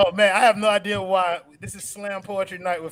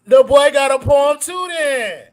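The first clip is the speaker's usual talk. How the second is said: louder, hyped announcement; 7 semitones higher, 10 dB louder, slower than usual. Real, not acted. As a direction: hype up the poem. Announcing his poem with hype.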